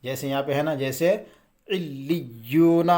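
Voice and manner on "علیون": علیون drawn out slowly, leaning hard on the doubled ل and ی; firm doubled-letter reading. Shown as a demonstration. Teacher showing students how to read tashdeed, firm slow علیون. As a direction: chant علیون slowly, pressing both doubled consonants. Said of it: "ایک تو اس کو سختی سے پڑھنا ہے".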